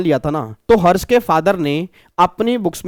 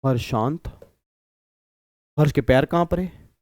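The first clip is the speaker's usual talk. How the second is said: stunned mutter; 4 semitones lower, 6 dB quieter than usual. Retold or imitated. Customer stunned, low aside.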